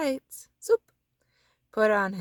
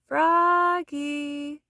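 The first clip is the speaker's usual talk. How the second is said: long, loud call; teacher calling; 6 semitones higher, 5 dB louder than usual. A narrator's reading. Teacher Miss Witherspoon calling a daydreaming Froggy, loud call. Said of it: "cried his teacher".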